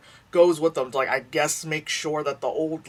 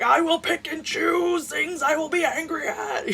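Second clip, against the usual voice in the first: much higher, half-laughing mock whine; mock self-righteous; 14 semitones higher, 3 dB louder than usual. Mocking the group's hypocrisy, high laughing mock voice. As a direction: lampoon a selective outrage, laughing through it. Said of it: "it's like".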